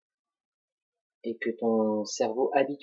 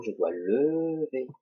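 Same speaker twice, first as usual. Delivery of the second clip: lever stretched long and raised; stress on lever; 3 semitones higher, 3 dB louder, slower than usual. Shown as a demonstration. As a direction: hold out lever to make the rule stick. Teacher stressing the pen lift. (accent appuyé et étiré sur lever)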